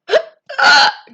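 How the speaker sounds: loud pretend sneeze burst; hammed-up sneeze; fake sneeze. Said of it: "I gotta sneeze".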